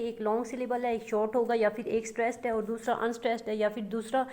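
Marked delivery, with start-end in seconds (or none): none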